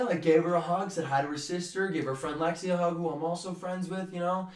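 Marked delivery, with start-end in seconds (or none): none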